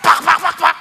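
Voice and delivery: loud, rapid smacking syllables; imitated slaps. Preaching hitting the listener, voiced as loud slaps. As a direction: fire off slap noises with the voice.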